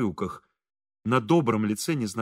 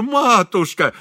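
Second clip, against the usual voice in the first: high, loud endearment; tearfully fawning; 7 semitones higher, 6 dB louder, slower than usual. A narrator's reading. Priest flattering the stern lady, touched. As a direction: gush it adoringly. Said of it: "умилился отец Фёдор".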